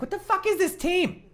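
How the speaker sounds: very high incredulous squawk; hammed-up baffled protest; mock disbelief. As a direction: act out an appalled kid's complaint. Voices disgusted kid at friend's.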